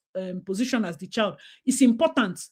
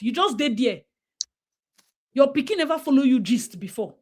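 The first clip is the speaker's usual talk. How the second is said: normal talking voice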